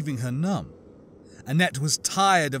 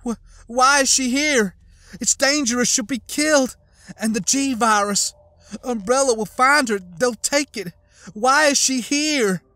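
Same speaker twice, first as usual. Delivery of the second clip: much higher, louder frantic questions; horrified panic; 10 semitones higher, 6 dB louder than usual. A narrator's reading. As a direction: panic for her daughter. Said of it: "waves of horrified disbelief".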